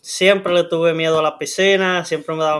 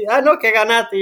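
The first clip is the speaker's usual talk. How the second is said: lightly voiced, higher mom; raised-pitch retold mom voice; imitated mother; 6 semitones higher, 4 dB louder than usual. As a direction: mimic a mother consoling a child with news. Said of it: "mi mamá llegó".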